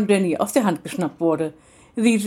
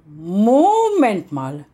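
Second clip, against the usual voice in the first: louder, slow and emphatic repeat; the second, louder alarmed take; alarmed realisation; 2 semitones higher, 4 dB louder, much slower than usual. Acted. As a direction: it suddenly clicks, say it out loud. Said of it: "stotterte sie".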